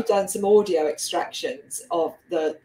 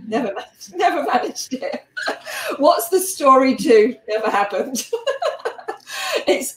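laughing; 5 semitones higher, 4 dB louder than usual. Real, not acted. Laughing at unwritten second book.

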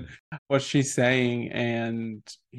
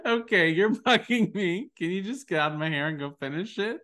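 higher-pitched mock exasperation; imitated annoyed brush-off; 6 semitones higher than usual. Quoting Abby brushing off her assignment partner, mock annoyed. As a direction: act out her fed-up brush-off through amusement. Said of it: "The way she says it is so funny".

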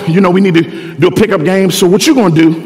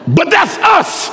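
much higher, shouted sermon climax; preaching at full force; 9 semitones higher than usual. Acted. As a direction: lift the church to its feet.

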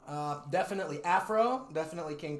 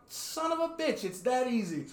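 astonished realization; 8 semitones higher than usual. Retold or imitated. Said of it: "I was like".

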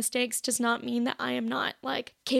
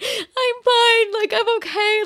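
high, loud wailed reassurance; hammed-up tearful protest; mock bawling; 9 semitones higher, 6 dB louder, slower than usual. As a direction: insist through imitated sobs, pitched way up. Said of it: "bawling in my vehicle".

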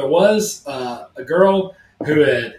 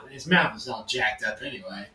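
ordinary delivery

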